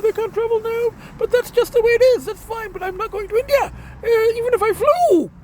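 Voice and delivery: high-pitched, agitated venting; real annoyance spilling out, pitched high; worked-up rant. Real, not acted. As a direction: let the irritation push the voice up. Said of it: "I'm just really pissed off".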